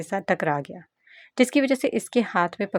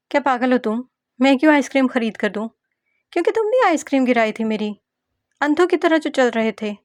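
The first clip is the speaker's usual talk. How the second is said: higher, louder back-and-forth; heated bickering; 6 semitones higher, 5 dB louder than usual. Acted. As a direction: argue hotly over the ice cream. Heated argument with the girl. (Ice cream पर झगड़ती तीखी आवाज़)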